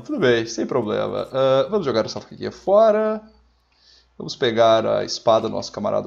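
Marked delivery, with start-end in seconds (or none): none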